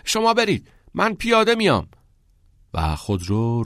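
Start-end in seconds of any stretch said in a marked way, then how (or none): 0.0-1.9 very high, loud call; raised, carrying shout. A narrator's reading. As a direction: yell to friends waiting in a car. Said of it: "ناکس فریاد زد".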